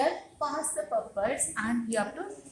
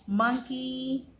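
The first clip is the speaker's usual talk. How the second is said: ordinary talk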